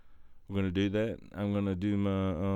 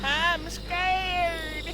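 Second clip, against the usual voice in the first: very high, drawn-out frightened whine; high-pitched terrified whimper; scared. Acted. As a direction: squeal it in hammed-up fear. Frightened in woods sketch.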